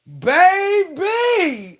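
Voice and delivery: high, loud drawn-out wail; wailing shout. Real, not acted. Telling her followers the climax, wailing shout. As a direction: let it rip.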